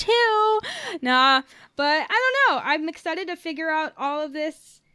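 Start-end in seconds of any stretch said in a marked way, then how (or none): 0.0-1.0 Ahch-To pitched high, ending in "too"; chosen playful pronunciation. Shown as a demonstration. Podcaster picking her own pronunciation, Ahch-To high and drawn out to "too". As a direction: sing out Ahch-To high, falling on a long "too". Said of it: "I'm gonna pronounce it".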